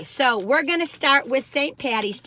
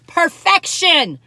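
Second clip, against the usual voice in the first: drawn-out rapture; 7 semitones higher, 9 dB louder, much slower than usual. Real, not acted. Savoring first bite.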